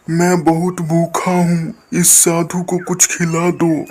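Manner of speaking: a wandering holy man asking humbly for food; hungry sadhu. Acted. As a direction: voice the old sadhu pleading gently.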